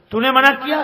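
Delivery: mother-in-law scolding her daughter-in-law. Retold or imitated.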